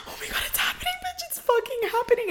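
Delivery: breathless hushed thrill, rising high; excited whisper. Retold or imitated. Retelling to her friend the moment it hit, hushed excited whisper. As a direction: whisper it like the drug just kicked in. Feeling the drug kick in.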